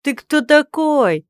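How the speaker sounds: high, loud, slow demand; rude challenge. Acted. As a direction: challenge him rudely. Stranger confronting the lord. (грубый вызов)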